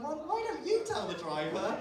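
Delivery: high, slow, incredulous comeback; indignant retort. Retold or imitated. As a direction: throw the question back, affronted. Retorting to fellow passenger in story.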